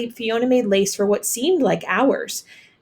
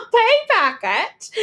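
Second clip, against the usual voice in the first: slow, high scoffing echo; 13 semitones higher, 4 dB louder, slower than usual. A narrator's reading. Landlady scoffing at the maid's hopes, high and slow. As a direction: scoff at the very idea. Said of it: "Missus O'Flaherty scoffed".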